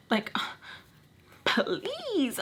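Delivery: soft, high, drawn-out mock plea; pleading. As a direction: beg quietly, stretching the please. Begging not to be left.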